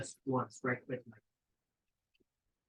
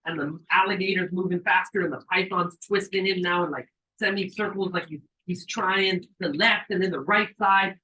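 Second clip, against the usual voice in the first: ordinary talk, nothing marked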